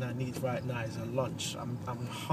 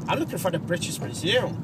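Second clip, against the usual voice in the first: put-on American accent; 5 semitones higher, 5 dB louder than usual. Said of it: "something's got an American accent".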